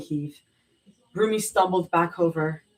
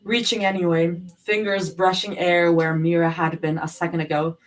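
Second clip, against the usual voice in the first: plain reading voice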